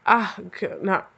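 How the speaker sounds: ordinary talk, nothing marked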